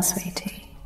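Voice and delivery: breathy whisper of sweetie; whispered endearment. Acted. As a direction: whisper the pet name close to the ear. Hypnotist praising the listener.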